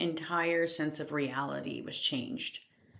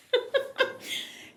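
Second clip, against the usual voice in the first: laugh. Real, not acted. Laughing at the strangeness.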